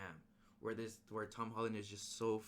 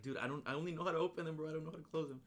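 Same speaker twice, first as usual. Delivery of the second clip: panicked; 7 semitones higher, 5 dB louder, much faster than usual. Retold or imitated. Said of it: "And, and Ned is like".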